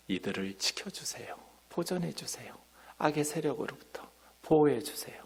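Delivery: whispered prayer; whispered plea. Acted. Preacher quoting the prayer for protection in a whisper. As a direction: breathe the plea out without voice.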